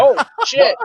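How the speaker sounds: high, squeaky shocked "oh shit"; mock shock. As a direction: play it as caught totally off guard. Reacting to friend's breakup.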